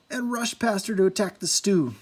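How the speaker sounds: ordinary delivery, nothing marked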